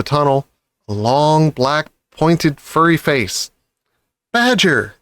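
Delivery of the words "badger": high startled "Badger!"; surprised cry. A narrator's reading. Fox startled by Badger's face, a high cry. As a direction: recognise an old friend. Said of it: "cried Mister Fox".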